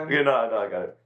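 usual speaking voice

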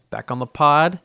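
loud, upbeat podcast welcome; excited intro. Real, not acted. Podcaster greeting listeners, loud excited welcome. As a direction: announce it to listeners. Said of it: "podcast intro".

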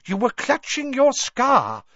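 high, loud, insistent; excited professor. A narrator's reading. Professor pressing a pupil about his scar, excited. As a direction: declare it high and eagerly.

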